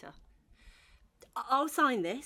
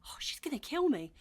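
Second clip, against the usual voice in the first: ordinary delivery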